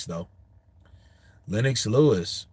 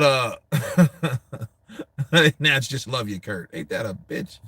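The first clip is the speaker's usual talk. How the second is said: usual speaking voice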